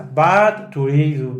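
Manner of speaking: ordinary talk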